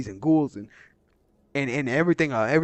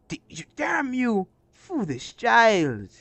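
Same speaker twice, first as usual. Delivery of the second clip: put-on higher, slow dying curse at the foolish child; cursing villain; 8 semitones higher, slower than usual. Defeated huntress curses the child who beat her, slow and bitter. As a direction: spit the words out as you fall.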